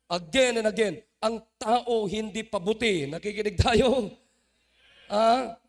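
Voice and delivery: loud, driving sermon cadence with hammered repeats; fiery preaching. Acted. Preacher warning the church about the human heart, fiery preaching. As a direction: preach it, pounding each repeat home.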